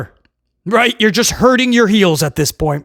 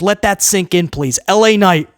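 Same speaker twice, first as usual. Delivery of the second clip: ordinary delivery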